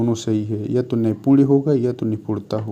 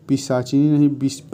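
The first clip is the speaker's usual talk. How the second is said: ordinary talk, nothing marked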